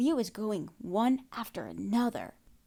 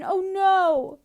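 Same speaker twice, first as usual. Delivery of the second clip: mock worried Lucy; 9 semitones higher, 8 dB louder than usual. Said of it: "cradles Steven and is like".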